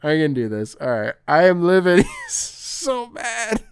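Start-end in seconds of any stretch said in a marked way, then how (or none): 1.3-3.6 laughing. Real, not acted. Laughing at email's anger.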